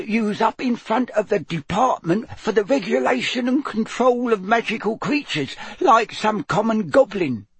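high, scolding elf voice; squeaky house elf. A narrator's reading. House elf recounts scolding her wayward friend, squeaking. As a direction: squeak a disapproving tirade. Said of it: "a teeny quivering squeak of a voice".

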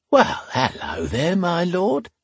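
slow, high flirtatious lilt; drawn-out come-on, climbing high at the end; flirty tavern woman. A narrator's reading. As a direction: purr a greeting as the forward barmaid.

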